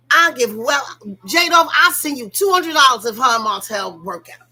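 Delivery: loud, emphatic promise; excited bet. Real, not acted. Promising J-Dove money over the couple, loud. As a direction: shout the bet out.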